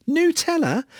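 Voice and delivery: high-pitched disbelieving "noo-TELL-a?"; incredulous echo. Real, not acted. Presenter questioning the website's answer, incredulous. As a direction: query it in utter disbelief, pitch leaping up.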